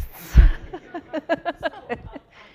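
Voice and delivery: loud, high burst of laughter; big high-pitched real laugh. Real, not acted. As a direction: laugh out loud in a long peal. Laughing after joking about contests.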